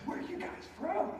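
high, astonished question from the moved waitress; surprised. Retold or imitated. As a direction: ask it high, in disbelief.

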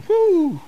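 mock relieved whew. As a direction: blow out the tension in a sigh of relief. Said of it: "And you walk away going".

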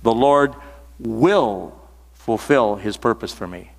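"will" drawn out long, set apart by pauses; pause, then stretch "will"; slow reading with "will" held; stress on will. Shown as a demonstration. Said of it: "I'm emphasizing the word will".

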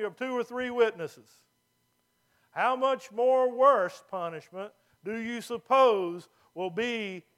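loud, slow, solemn Bible reading; scripture reading. Acted. Preacher reading Hebrews aloud to the church, loud and solemn. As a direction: proclaim the verses to the congregation.

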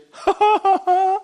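high, loud string of ha-has; exaggerated high-pitched laughter; mock laugh. Retelling reaction to church numbers.

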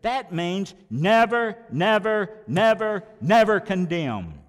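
loud, slow hammered never never never; emphatic never. Real, not acted. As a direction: pound each never home. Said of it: "this emphatic negative adverb says".

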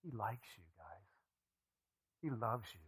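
a whisper of comfort for the congregation; whispered reassurance. Acted. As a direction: lean in and whisper it.

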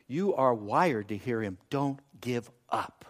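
low, soft, slowing to spaced words; quietly encourage, letting each word land; gentle reassurance. Real, not acted. Preacher to those who struggle hearing God, gently reassuring.

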